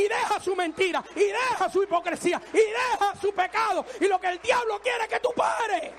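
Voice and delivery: rising, shouting preacher's cadence; fiery preaching. Acted. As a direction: preach it to the congregation with force. Preaching at full force. (predicación encendida)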